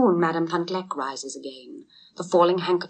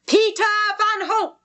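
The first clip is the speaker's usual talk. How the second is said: very high, loud shout; crier's shout; 4 dB louder than usual. A narrator's reading. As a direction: proclaim the winner. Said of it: "shouted the crier".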